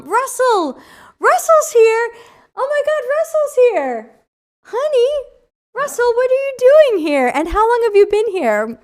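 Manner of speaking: high, loud, delighted squeals; real excited squeal at a best friend; thrilled. Real, not acted. Best friend appears in chat.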